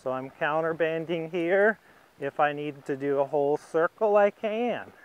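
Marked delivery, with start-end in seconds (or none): none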